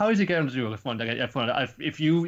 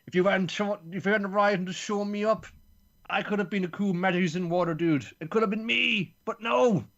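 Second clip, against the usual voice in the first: high, louder resentful voice; bitter accusing rant; 9 semitones higher, 3 dB louder than usual. Retold or imitated. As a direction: pour out the grudge as you blame him. Ho blames Bam.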